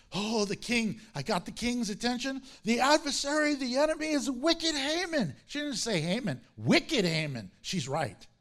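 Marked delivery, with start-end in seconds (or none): 0.1-5.3 eager accusation. Pretend. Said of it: "She's probably like".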